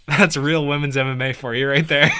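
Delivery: usual speaking voice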